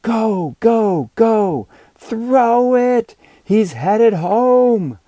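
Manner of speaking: put-on high, loud kid's cheer, slowing on the last words; excited cheering. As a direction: cheer your runner home at the top of your voice. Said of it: "Both teams erupted in cheers".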